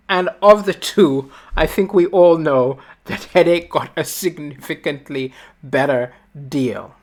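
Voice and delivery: ordinary talk, nothing marked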